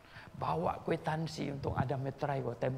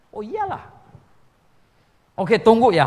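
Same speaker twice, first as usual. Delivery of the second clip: excited agreement; 7 semitones higher, 17 dB louder than usual. Retold or imitated.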